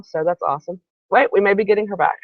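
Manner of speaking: usual speaking voice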